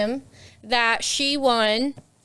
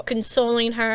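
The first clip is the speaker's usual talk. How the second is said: ordinary talk, nothing marked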